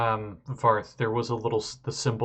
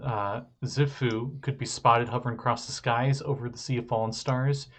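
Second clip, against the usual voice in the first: normal talking voice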